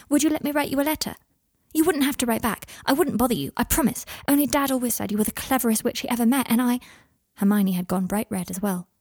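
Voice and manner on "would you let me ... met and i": put-on high boy's voice, rushing on; shy, eager Scorpius. Scorpius asking Hermione to write, bashfully eager. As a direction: blurt the request in a raised, eager voice.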